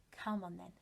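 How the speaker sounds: usual speaking voice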